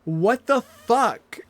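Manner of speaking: drawn-out loud outrage; slow angry shout. Real, not acted. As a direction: drag it out furiously. Raging at game.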